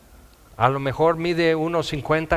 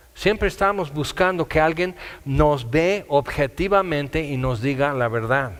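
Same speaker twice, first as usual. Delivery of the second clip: ordinary delivery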